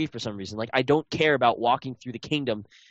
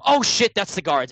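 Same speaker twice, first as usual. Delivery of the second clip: higher, louder and slow, caught off guard; pitched-up startled cry; alarmed outburst; 7 semitones higher, 5 dB louder than usual. Retold or imitated. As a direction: blurt it out as you spot the guards. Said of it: "and I'm like".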